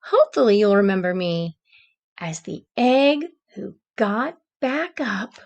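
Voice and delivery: gentle read-aloud storytelling voice; story narration. A narrator's reading. Teacher reading a storybook to the class, gentle narration. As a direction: read it like a bedtime picture book.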